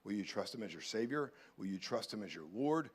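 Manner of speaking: ordinary talk, nothing marked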